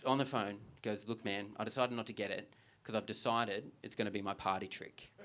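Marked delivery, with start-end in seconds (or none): 1.1-5.1 imitating a resolute mate, low and soft; earnest conviction. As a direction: state it quietly, as a settled decision. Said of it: "such was the conviction in his voice".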